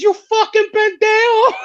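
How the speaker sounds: retold curse thrown high and sharp; mock insult yell. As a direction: hurl the insult in a hammed-up yell. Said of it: "the first thing out of Manny is".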